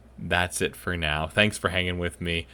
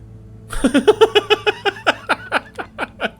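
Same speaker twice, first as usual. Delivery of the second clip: big burst of high laughter; loud high laugh; 7 dB louder than usual. Real, not acted. Player cracking up at a silly message, loud laugh.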